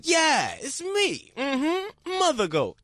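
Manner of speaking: high, sassy diva voice; Mother Goat impression. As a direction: purr it like a proud diva. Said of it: "she just was like".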